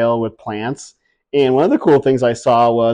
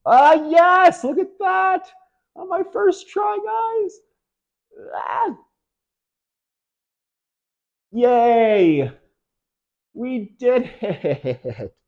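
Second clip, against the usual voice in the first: much higher, squealing victory cheers; triumphant squeal; much slower than usual. Real, not acted. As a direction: squeal with joy at success. Finally lands the throw.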